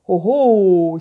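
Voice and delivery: loud oh oh of wonder; a loud marveling oh oh; delighted exclamation. A narrator's reading. Coyote marvels at root. (oh oh émerveillé et fort)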